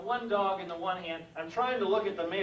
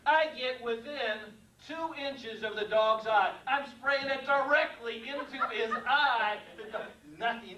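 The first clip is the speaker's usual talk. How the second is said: ordinary delivery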